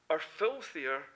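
ordinary talk

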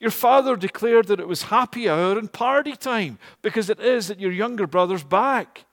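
loud, high joyful news; happy voice. Acted. Servant telling elder brother, loud and happy. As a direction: burst out with the good news. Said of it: "says in a happy voice".

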